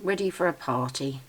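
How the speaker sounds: normal talking voice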